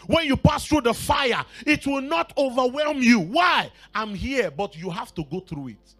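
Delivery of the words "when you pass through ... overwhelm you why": loud, high preaching cry; fiery preaching. Acted. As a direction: preach it out to the whole church. Preacher at full force.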